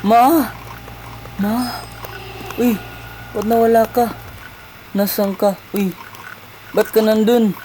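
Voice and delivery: high calls for mother; voiced child looking for mother; child calling mom. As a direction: call out anxiously for Ma.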